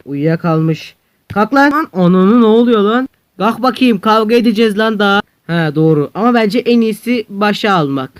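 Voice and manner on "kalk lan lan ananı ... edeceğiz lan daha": loud rough wake-up yell; shouting at a sleeping rival; angry shout. Acted. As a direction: bark him awake for the fight. Waking sleeper to fight.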